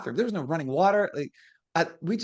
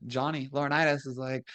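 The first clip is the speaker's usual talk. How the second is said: ordinary delivery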